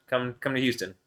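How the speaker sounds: ordinary talk, nothing marked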